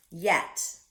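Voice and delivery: plain reading voice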